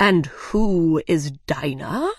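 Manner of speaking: slow, low rising question; puzzled dodo. Acted. The dodo asks Alice who Dinah is, slow and puzzled. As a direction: ask it slowly, puzzled. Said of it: "the dodo asked".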